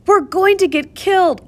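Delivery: high, loud frightened cry; panicked cry. A narrator's reading. Rivka fleeing the worker, panicked cry. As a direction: blurt it out in panic. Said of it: "I cried".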